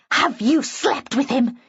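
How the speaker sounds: tense interrogation, hissed with full voice. A narrator's reading. Petra inches from the narrator's face, hissed accusation. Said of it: "she hissed".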